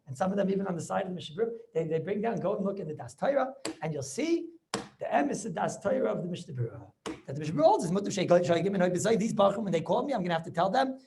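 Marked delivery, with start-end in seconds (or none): none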